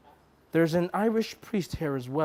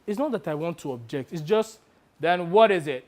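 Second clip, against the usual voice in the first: usual speaking voice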